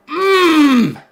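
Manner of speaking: high, loud closed-mouth roar; drawn-out triumphant mmm, pushed hard; loud exultant hum. Real, not acted. Streamer celebrating a late winner, loud exultant hum. Celebration after announcing observe.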